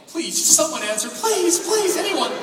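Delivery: high, loud, hammed-up begging; mock desperate pleading. Caller begging anyone to pick up, hammed-up desperation. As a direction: plead frantically, sending your pitch way up.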